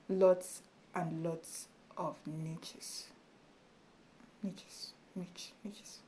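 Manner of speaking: soft unvoiced whisper; whispering to herself about niches. Real, not acted. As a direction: breathe the words out as a whisper.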